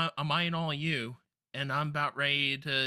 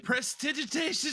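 "prestidigitation" pitched much higher, theatrically; playful spell-casting; 9 semitones higher than usual. Pretend. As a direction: sing out "prestidigitation" like a showy magician.

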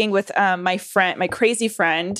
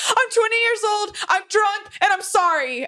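much higher, panicky confession; nervous blurting; 13 semitones higher than usual. Retold or imitated. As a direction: blurt it all out like a scared kid. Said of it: "I start blurting out the truth".

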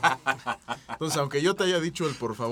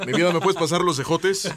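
louder, laughing through the request; loud laughing ask; 4 semitones higher, 8 dB louder than usual. Shown as a demonstration. Asking partner for ejotes, louder with a laugh. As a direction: ask your partner for the green beans half-laughing and loud. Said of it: "en la forma de cómo lo decimos".

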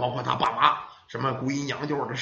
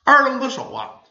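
ordinary delivery, nothing marked

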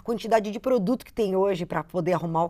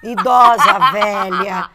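laughing; 12 dB louder, much slower than usual. Real, not acted. Jokes about her old voice.

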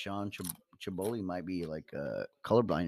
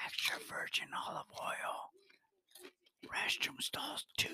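imitated whisper; 14 dB quieter than usual. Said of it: "quoted whisper".

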